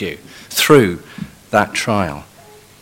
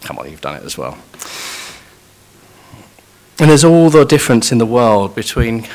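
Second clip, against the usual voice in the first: normal talking voice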